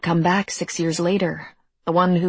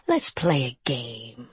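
low, slow, drawn-out invitation; amiable creature; 5 semitones lower, 4 dB quieter, slower than usual. A narrator's reading. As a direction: toy with them in a low, leisurely voice. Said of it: "he said amiably".

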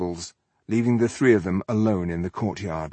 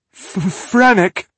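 high, loud, broken stammer on his name; frightened stutter, voice jumping up; stammering in fear; 12 semitones higher, 8 dB louder, slower than usual. A narrator's reading. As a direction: stumble out the name in terror. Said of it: "he stammered".

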